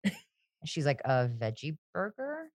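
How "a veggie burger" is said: softly, slowly imitate a hesitant question; quiet, drawn-out tentative ask; small, unsure mock reply; timid retold question. Meek reply to annoyed friend.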